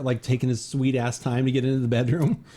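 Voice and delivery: ordinary delivery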